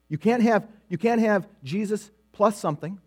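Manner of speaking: ordinary delivery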